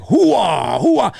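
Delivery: lazy Pacino catchphrase shouted; mock Pacino shout. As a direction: shout it like everyone doing Pacino. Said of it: "how everybody's like".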